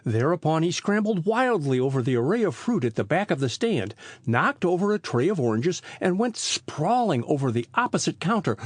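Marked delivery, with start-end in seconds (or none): none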